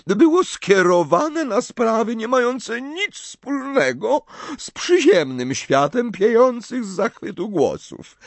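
high, slow, dignified seer's pronouncement; the offended prophetess declaring with dignity. Acted. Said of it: "oświadczyła z godnością".

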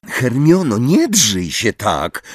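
ordinary delivery

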